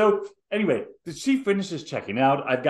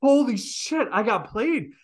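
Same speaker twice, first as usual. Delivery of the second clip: put-on teen realizing the lady tricked her; stunned alarm; 8 semitones higher, slower than usual. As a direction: blurt it in shock. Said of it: "And I was like".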